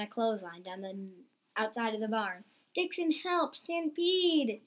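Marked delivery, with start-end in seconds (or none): 2.7-4.6 high-pitched cry for help; storybook yell for help. A narrator's reading. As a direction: call out the alarm high and urgent. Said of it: "yelled Caddy".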